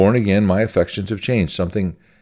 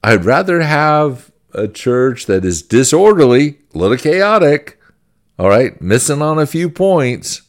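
higher, louder quoted voice, lightly acted; Richard Baxter; 8 semitones higher, 5 dB louder than usual. Preacher voicing Baxter's preference for a saved church, raised emphatic quote. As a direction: quote him emphatically, pitched up and loud. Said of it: "But he goes".

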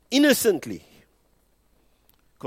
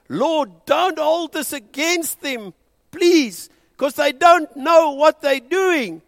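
high, loud, slow pleading; loud plea; 9 semitones higher, 6 dB louder, slower than usual. A narrator's reading. Preacher voicing Stephen's last words, a loud plea. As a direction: cry out to God, begging. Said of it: "his last words were".

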